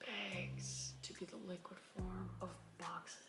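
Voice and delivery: very soft, thinking aloud; hushed, puzzled musing; pondering quietly. Real, not acted. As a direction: repeat it to yourself under your breath. Mulls over the question just read.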